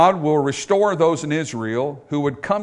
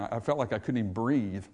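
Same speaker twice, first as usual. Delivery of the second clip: hushed; 4 semitones lower, 9 dB quieter than usual. Retold or imitated. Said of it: "It's just an expression".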